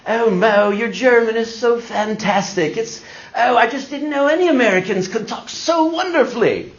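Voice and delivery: high, put-on flattering voice; hammed-up polite intellectual, cooing with admiration; gushingly polite. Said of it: "they were the most polite".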